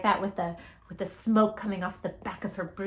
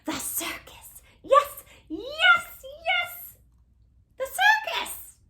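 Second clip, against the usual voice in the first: thrilled witch; 4 dB louder, slower than usual. Acted.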